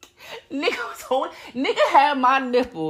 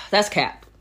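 ordinary talk, nothing marked

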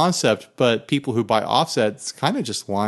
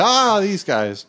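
slow, knowing high drawl; fond recognition; 8 semitones higher, slower than usual. Retold or imitated. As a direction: realize it with a fond groan. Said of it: "And I'll be like".

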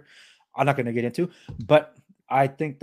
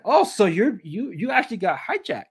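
higher-pitched feigned surprise; pitched-up "oh, so that's it" reaction; mock realization; 7 semitones higher than usual. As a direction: act out suddenly catching on.

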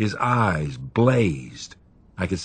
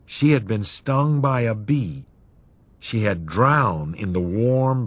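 ordinary delivery

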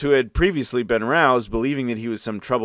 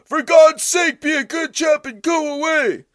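very high, loud indignant chorus; shrill neighbors shouting from windows; baying neighbors; 5 dB louder than usual. A narrator's reading. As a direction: scold him like outraged old ladies. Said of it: "started to bay at him".

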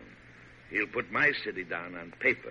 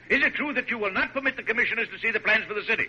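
raised, higher, impatient demand; irritated; 12 semitones higher, 4 dB louder than usual. Acted. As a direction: press him hard, out of patience. President confronts the stubborn major.

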